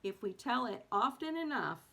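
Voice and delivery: steady, measured reading of a book chapter; calm expressive reading voice; reading aloud. Acted. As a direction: read it to listeners like an audiobook.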